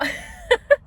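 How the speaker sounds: high shrieking laugh breaking in; real laughing shriek. Real, not acted. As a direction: crack up hard. Cracks up mid story.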